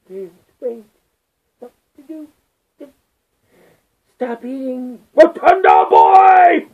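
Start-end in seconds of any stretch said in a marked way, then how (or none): 2.8-6.7 loud, slow mocking yell; taunting shout. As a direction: bellow the taunt across the room. Yelling a taunt.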